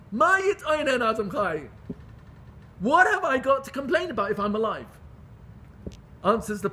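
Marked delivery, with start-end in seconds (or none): 0.1-4.9 loud, higher-pitched rhetorical challenge; indignant preaching. Retold or imitated. A preacher driving home why not to complain, indignant. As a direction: cry out the verse in protest. Verse repeated as an indignant question.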